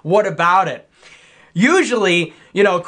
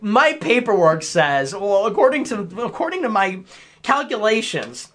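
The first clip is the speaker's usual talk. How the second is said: higher, halting pedant imitation; mock debate nerd; 4 semitones higher than usual. Debate nerd citing his paperwork, mocking. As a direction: mimic a fussy nerd stumbling over his figures. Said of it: "it triumphs over".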